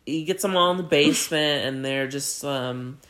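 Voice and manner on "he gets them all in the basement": ordinary delivery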